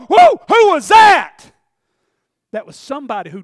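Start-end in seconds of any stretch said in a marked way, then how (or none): 0.1-1.5 high, loud, slow cry of amazement; loud, high astonished whoa; shout of wonder. Retold or imitated. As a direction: cry out like you just met God. Wild cry in the Spirit.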